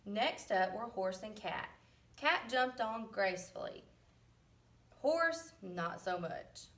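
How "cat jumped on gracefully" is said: ordinary delivery, nothing marked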